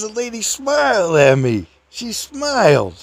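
high, excited, slowing on she smiled; overjoyed exclaim. Real, not acted. Narrator marveling that the frowny lady smiled at him, giddy. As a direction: cry it out with giddy delight.

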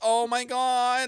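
high, slow dreamy gasp; mock swoon. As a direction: swoon over him mockingly.

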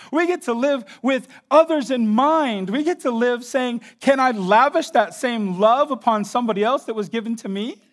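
high, loud pulpit delivery; fervent preaching. Real, not acted. Preacher urging congregation to live for God, fervently. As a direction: drive each phrase home to the congregation.

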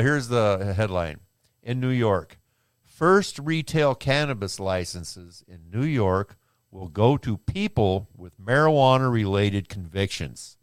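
slow, careful word-by-word read; over enunciating. Real, not acted. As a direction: enunciate every word flatly. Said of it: "I understand you wanted to enunciate every fucking word".

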